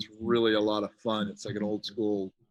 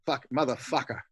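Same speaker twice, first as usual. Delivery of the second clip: ordinary talk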